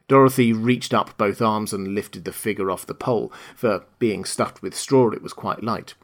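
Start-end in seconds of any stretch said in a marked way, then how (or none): none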